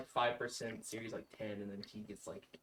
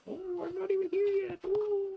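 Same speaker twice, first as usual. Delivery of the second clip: high pet voice; 12 dB louder than usual. Acted. Voicing a pet character.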